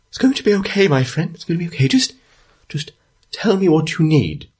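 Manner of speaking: a high, kindly voiced marshal; gentle reassurance. Town marshal patting the old man's shoulder, high gentle comfort. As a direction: comfort him like a trusted friend, gently. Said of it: "maybe surprising, um, sensitivity".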